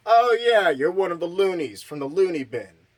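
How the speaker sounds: high, loud sarcastic jeer; mock scorn. Sheriff mocking Tommy, sarcastic jeer. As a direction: sneer at him.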